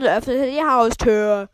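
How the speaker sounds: reading out too loud. Real, not acted. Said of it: "Warum rede ich so laut?".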